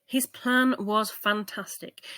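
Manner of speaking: ordinary delivery, nothing marked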